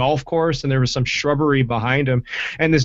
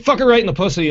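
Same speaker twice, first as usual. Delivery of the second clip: mock yell; 7 semitones higher, 3 dB louder, much faster than usual. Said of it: "looked in the camera and yelled".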